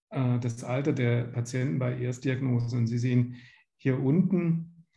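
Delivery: ordinary talk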